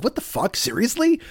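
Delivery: mock amazed. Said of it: "And you're just like".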